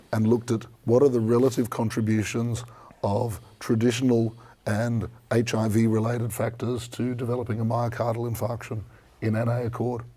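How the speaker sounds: usual speaking voice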